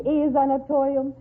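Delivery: ordinary delivery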